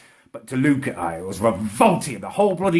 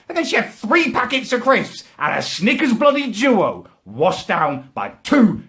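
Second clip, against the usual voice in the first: ordinary talk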